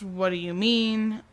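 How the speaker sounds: usual speaking voice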